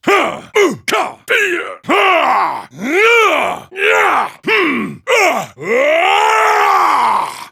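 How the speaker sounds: sharp, high, loud martial-arts grunts and yells; punchy fight effort sounds; combat kiai shouts. Acted. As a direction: bark each strike out hard.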